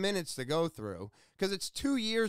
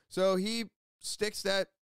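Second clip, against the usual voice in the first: talking through hiccups; hiccuping mid-sentence; 3 semitones higher than usual. Real, not acted. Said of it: "I got the hiccups now".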